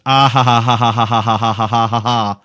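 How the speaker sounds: loud, mock-maniacal ha ha ha, read out like a string; read-out email laughter. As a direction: hammer out each ha loud and evenly.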